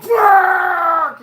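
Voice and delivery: drawn-out, high screamed curse; imitated furious yell. Fat dude working on trim, imitated long angry scream. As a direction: mimic a worker losing it at full volume. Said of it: "I don't like people yelling in my house".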